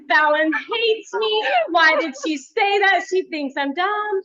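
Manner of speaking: mock wounded complaint; imitating a hurt colleague after a harsh remark; hurt coworker. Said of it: "She would be like".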